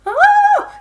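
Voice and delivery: short high shriek of alarm; sudden scream. Retold or imitated. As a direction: let out a startled yelp of panic. Retelling character's panic scream.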